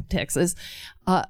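ordinary delivery, nothing marked

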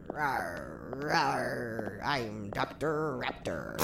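lightly growled dinosaur voice, slow; soft, slow playful raptor growl; Doctor Raptor voice. Pretend. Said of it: "I have to hear you do the voice".

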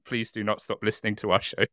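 ordinary talk

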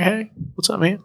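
high, soft, easygoing mock country hello; laid-back greeting. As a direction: greet them like a chill islander. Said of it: "fairly chill".

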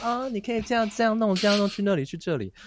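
put-on high, light young woman giving directions; a man voicing the lobby girl high and sweet; Beijing girl's voice. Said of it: "然后她就说".

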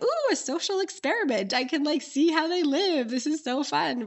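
high-pitched, thrilled curiosity; gleeful amateur academic. Retold or imitated. Amateur academic delighted by a social experiment, gleeful. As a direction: squeal it like a delighted researcher. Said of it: "amateur academic in me was like".

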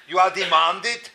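ordinary talk

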